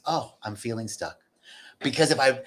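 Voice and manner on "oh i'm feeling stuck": soft, low self-acknowledgement; quiet acceptance. Acted. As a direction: drop to a quiet, accepting admission. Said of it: "this is the beginning of acceptance".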